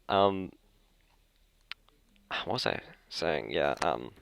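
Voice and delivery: normal talking voice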